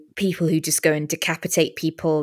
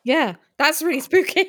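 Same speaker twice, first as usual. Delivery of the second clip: laughing; 10 semitones higher than usual. Real, not acted. Laughing at spooky noise.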